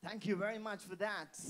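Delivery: ordinary delivery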